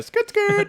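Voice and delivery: very high squeaky skid sound; mouse skid noise. Retold or imitated. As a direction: imitate the mouse skidding on concrete. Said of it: "skirting everywhere".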